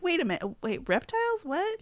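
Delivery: high-pitched, incredulous double take; acted-out confusion, leaping up high; baffled reaction. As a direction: squeak it out in mock disbelief. Acts out her baffled imagining.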